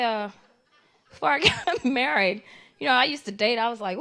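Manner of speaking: ordinary talk, nothing marked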